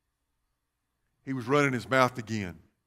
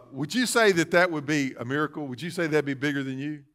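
ordinary talk

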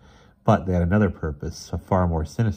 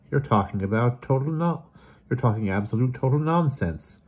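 incredulous; 7 semitones higher than usual. Acted. Bewildered sister rejects his story.

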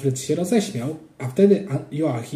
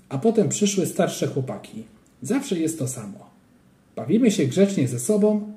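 ordinary delivery, nothing marked

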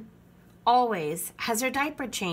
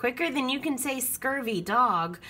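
ordinary delivery, nothing marked

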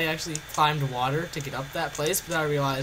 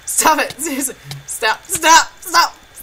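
panicked yelling; 7 semitones higher, 9 dB louder than usual. Real, not acted. Being hit with spoon.

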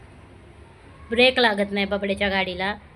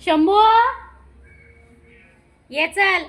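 high, loud summons to a pet; ringing come-here call; 10 semitones higher, 7 dB louder, slower than usual. Real, not acted.

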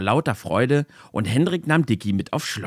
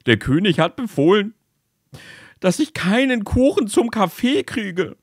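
crying soldier; 10 semitones higher, 4 dB louder than usual. Acted. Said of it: "weinte noch lauter".

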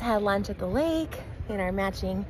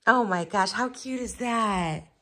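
ordinary delivery

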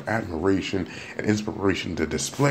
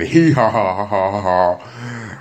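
spelled-out fake laugh; 2 semitones higher, 5 dB louder, much slower than usual. Forced laugh along.

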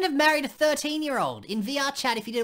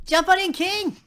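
calling out; 5 semitones higher, 5 dB louder, slower than usual. Acted. Calling the king into tub.

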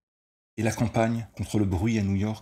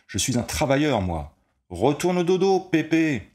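loud, higher mocking yell; jeering shout; 9 semitones higher, 6 dB louder than usual. A narrator's reading. Ball player jeering up at the window, loud taunt. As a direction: taunt the old man. Player jeers at the old man.

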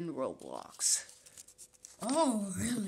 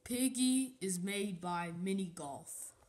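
ordinary talk, nothing marked

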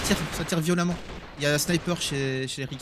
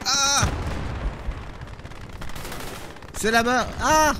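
startled cries; 7 semitones higher, much slower than usual. Real, not acted. Startled cries under attack.

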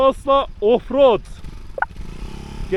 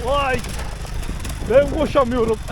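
panting after running uphill; tired, short of breath; 2 semitones higher than usual. Real, not acted.

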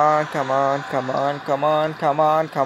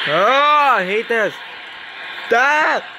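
high, exasperated wail; frustrated outcry; 9 semitones higher, slower than usual. Real, not acted. Player fed up with the game, frustrated high outcry. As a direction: cry out in protest, voice way up.

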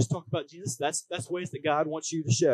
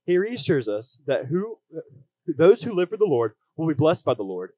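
upbeat youth pastor; 5 dB louder than usual. Acted. Preaching with growing force.

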